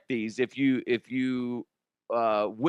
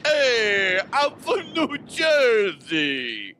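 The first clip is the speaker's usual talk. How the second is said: loud, much higher, slow mock New Jersey shout; New Jersey accent; 10 semitones higher, 5 dB louder, much slower than usual. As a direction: bellow like a cartoon New Jersey guy. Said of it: "I don't know why I did that".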